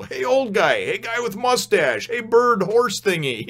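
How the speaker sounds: high, slow cheerful hails; high, drawn-out goofy greetings; goofy Luffy calling out. Retold or imitated. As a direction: sing out each silly nickname like carefree Luffy. Imitating how Luffy addresses people.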